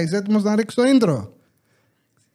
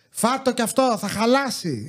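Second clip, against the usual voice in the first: imitating mother; 4 semitones higher than usual. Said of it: "Είναι το".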